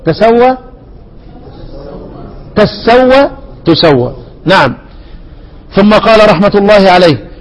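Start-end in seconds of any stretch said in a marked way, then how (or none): 0.0-4.2 تسوى said as tassawwa, then tasawwa, then tusawwa; three readings. Shown as a demonstration. Teacher summing up the three readings of تسوى. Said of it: "فيها ثلاث قراءات".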